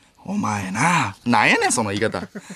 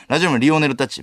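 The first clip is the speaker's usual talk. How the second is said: usual speaking voice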